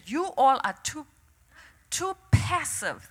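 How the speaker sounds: soft, halting divine remark, lightly voiced; understated voice of God; the Lord's quiet word. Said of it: "The Lord turned to me and said".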